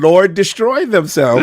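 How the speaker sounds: mock proclamation. Real, not acted. Banter mock title, very high.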